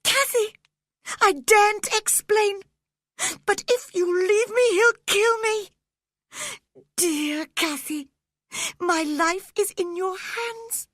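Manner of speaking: put-on frightened sickly boy, pleading; terrified boy pleading. A narrator's reading. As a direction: beg her as if your life hangs on it. Linton pleading in terror.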